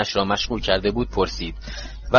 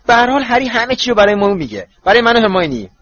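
high, loud, eager protest; heated; 9 semitones higher, 6 dB louder than usual. A narrator's reading. Boy insists his friend will tell him everything, heatedly. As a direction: argue hotly, pitched well up. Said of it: "رون با حرارت گفت".

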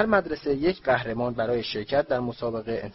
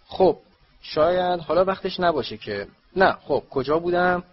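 flustered; 7 semitones higher, 6 dB louder than usual. Acted. Dumbledore flustered after a cough.

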